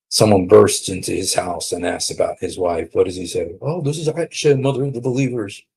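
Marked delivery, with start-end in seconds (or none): 3.6-5.6 higher, hurried deflecting answer; mock meek reply. Scared husband answering the intruder about his wife, mock meek. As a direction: blurt it out high and quick, mockingly timid.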